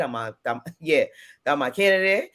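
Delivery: ordinary talk, nothing marked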